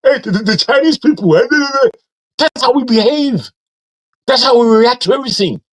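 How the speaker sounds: high, loud mocking voice of an old Nigerian auntie; imitating auntie. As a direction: ham up a scandalised auntie, pitched high. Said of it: "an auntie of mine said".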